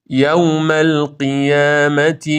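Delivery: slow chanted یوم القیامت; drawn-out recited یوم القیامت; model recitation. Shown as a demonstration. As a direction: stretch یوم القیامت with an open "yaw". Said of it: "فتحه نباید بره سمت ضمه".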